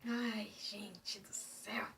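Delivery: slow whispered despair. Real, not acted. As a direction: breathe it out slowly. Suffering the bad taste.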